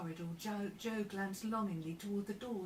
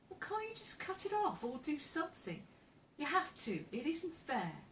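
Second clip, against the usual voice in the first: much higher-pitched, indignant plea; pleading protest; 7 semitones higher than usual. A narrator's reading. Joe begs the doctor to cut it off, protesting. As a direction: throw caution to the wind and demand help.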